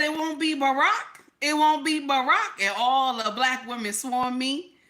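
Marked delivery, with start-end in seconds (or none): 0.0-2.6 high, loud teasing refusal; defiant tease. Retold or imitated. Speaker teasing her auntie at the polls, high defiant tease. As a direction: tease them with a fake refusal. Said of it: "I said".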